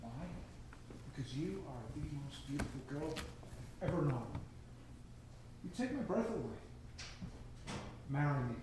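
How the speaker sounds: tender. Acted. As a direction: comfort her gently. Man tenderly proposing to her.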